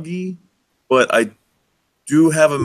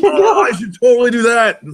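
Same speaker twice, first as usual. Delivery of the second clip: loud, high excited shout; 10 semitones higher, 5 dB louder than usual. Real, not acted. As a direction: jump at the idea. Excited about the DJ idea.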